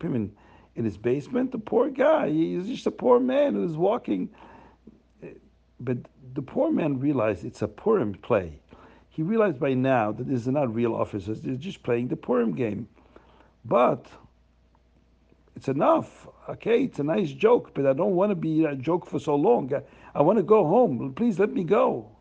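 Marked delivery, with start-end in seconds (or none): 1.5-4.3 pitying townsfolk. Acted. Townsfolk pity the man. 13.6-22.1 high, loud plea to end the joke; fed-up pleading. Acted. Prisoner pleads again.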